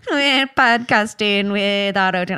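accidental Irish lilt; a hint of an Irish accent. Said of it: "you sound like an Irish person".

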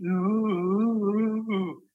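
high wavering ghostly ooh; ghost moan. Retold or imitated. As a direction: mimic a spooky ghost moan. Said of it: "And it was literally".

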